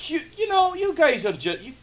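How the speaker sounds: high put-on voice teasing the hosts; mock Angus voice. Host voicing Angus, teasing the co-hosts in a high silly voice. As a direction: squeak it out like a cartoon sidekick.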